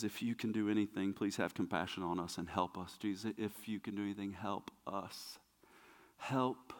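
very low, barely voiced begging; hushed plea. Hurting father begging Jesus for help, hushed and low. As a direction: plead quietly, worn down.

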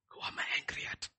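whispered, as if to oneself; hushed, voiceless acted self-doubt; whispered self-questioning. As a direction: whisper the dawning realisation. Acting David's realisation to himself.

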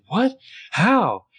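mock shock at Cyrus news. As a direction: snap up high in disbelief.

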